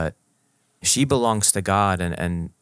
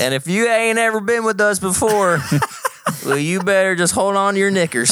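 put-on country accent; 12 semitones higher, 5 dB louder than usual. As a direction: ham up a backwoods host. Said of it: "in country accents".

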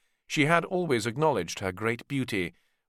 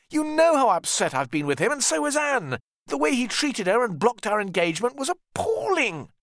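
high, louder aggrieved voice; indignant outburst; 13 semitones higher, 4 dB louder than usual. Acted. Richard tells Ned how George wronged Anne, high aggrieved outburst. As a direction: pour out the grievance.

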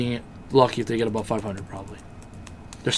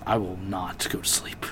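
mock whisper; 4 semitones lower, 9 dB quieter than usual. Joking about guarding Zeke closely.

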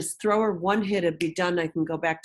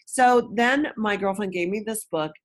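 ordinary talk

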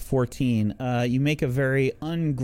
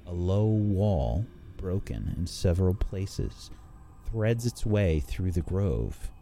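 slow, hushed storyteller read; scene narration; 6 semitones lower, 6 dB quieter, slower than usual. A narrator's reading. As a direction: paint the scene slowly.

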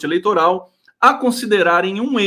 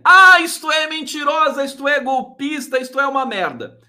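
mocking critics; 9 semitones higher, 4 dB louder than usual.

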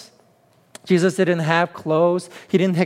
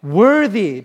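higher, louder protest of unworthiness; self-conscious Peter; 4 semitones higher, 4 dB louder than usual. Acted. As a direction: Peter protesting to Jesus, pitch raised. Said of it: "acting out here, self-consciousness".

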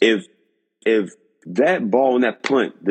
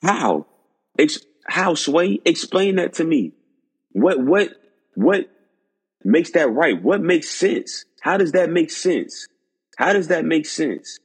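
high-pitched, baffled questioning; exasperated disbelief, each question pitched high; real incredulity; 7 semitones higher than usual. Real, not acted. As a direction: demand an explanation, pitch way up. Challenges the coach's stated reason.

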